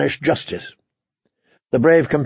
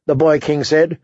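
ordinary delivery

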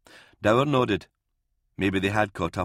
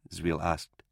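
plain reading voice

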